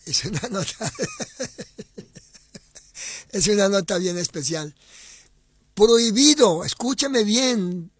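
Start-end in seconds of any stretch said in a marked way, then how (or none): 1.0-3.3 long high, wheezy chuckle; helpless high cackle trailing on; laughing. Real, not acted. Host laughs mid-remark. 5.7-7.9 loud, high, slow command to listen; forceful raised-voice warning; emphatic warning. Real, not acted.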